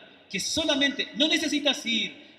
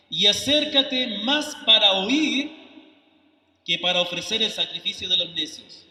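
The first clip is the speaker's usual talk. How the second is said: solemn scripture reading; 4 dB louder than usual. Shown as a demonstration. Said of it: "reads the verse, then repeats it". (lectura bíblica solemne, lenta y fuerte)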